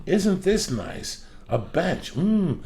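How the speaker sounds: high, drawn-out fake admiration; mocking gawking couple. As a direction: coo over the gym bench like a clueless tourist.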